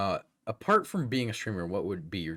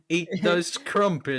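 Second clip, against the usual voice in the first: slow, high, loud British voice; hammed-up posh Brit; British accent; 9 semitones higher, 7 dB louder, slower than usual. Mocking English stereotype.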